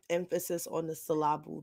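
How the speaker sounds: "Emphasis" and "syllable" stressed on their second syllables; misplaced stress. Shown as a demonstration. Grumbling at co-host over word stress, misplaced stress. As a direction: say "syllable" as suh-LAH-bul, softly. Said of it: "where the emphasis on the syllable goes".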